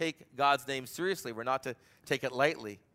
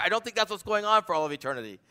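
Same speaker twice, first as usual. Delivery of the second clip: normal talking voice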